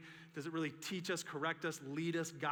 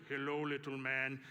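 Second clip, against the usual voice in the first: low, slow giant's voice; hammed-up giant greeting a small man; mock big-guy voice; 3 semitones lower than usual. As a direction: loom over him with a deep hello. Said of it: "He didn't talk like that".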